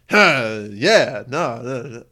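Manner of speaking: loud, erratic babble; mock-crazed outburst; acting insane. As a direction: play someone sounding unhinged, loudly. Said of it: "I'll sound insane".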